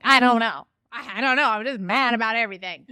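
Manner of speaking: loud, exasperated venting; frustrated outburst. Retold or imitated. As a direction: blurt it out, fed up with everything. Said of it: "I'm like".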